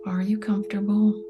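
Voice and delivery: ordinary delivery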